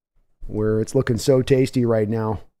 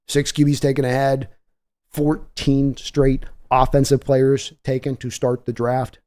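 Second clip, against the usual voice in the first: ordinary talk, nothing marked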